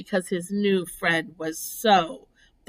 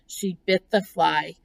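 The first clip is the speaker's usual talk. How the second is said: ordinary delivery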